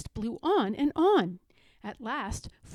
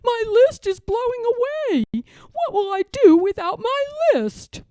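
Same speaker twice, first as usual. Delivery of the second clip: high, louder, frantic character voice; panicked Toad; 11 semitones higher, 8 dB louder than usual. Acted. Toad panics to Frog about his list, high and frantic. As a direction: sound desperate as the list flies away.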